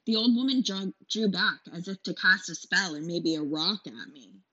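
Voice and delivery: ordinary delivery, nothing marked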